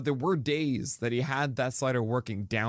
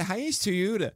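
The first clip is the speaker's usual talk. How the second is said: "Hyannis Toyota" in a higher, lightly mocked Baltimore accent; 7 semitones higher than usual. Recalling the Cape Cod Toyota ad, light mock Baltimore accent. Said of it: "he would always say".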